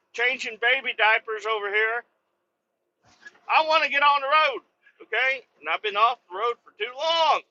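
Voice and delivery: high-pitched exasperated rant; frustrated rant. Real, not acted. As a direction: vent about being stuck in the shop. Ranting about being stuck.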